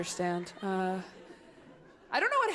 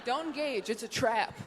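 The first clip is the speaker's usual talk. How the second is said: ordinary talk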